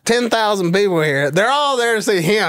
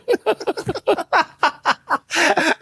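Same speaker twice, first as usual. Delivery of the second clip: long, high burst of laughter; big laugh; 10 semitones higher than usual. Real, not acted. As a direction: laugh hard at the memory. Laughs after retelling exit.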